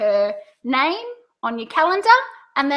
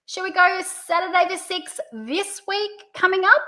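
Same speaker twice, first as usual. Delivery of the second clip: held high, rising on "coming up"; pitch up on first option; 6 semitones higher than usual. Shown as a demonstration. Consultant offers the first date, pitch going up. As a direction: swing "up" high to leave it open. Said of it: "Are you hearing the pitch?".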